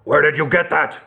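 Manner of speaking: ordinary delivery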